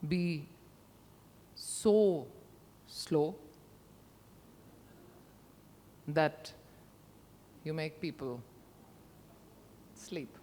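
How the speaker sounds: slow, fading sleepy drag. Shown as a demonstration. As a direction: drag it out until it fades. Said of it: "rate or pace".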